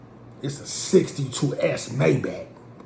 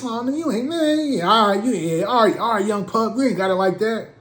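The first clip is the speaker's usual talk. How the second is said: high teasing put-on voice; mocking friend voice; 8 semitones higher than usual. Homies taunting him that he struck out, high mocking voice. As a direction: lay it on thick, taunting the young pup.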